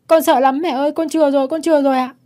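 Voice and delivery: put-on high, loud, terrified child's plea; acted frantic begging to mother; tearful child begging. A narrator's reading. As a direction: plead desperately as a small boy. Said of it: "nước mắt ngắn dài van xin".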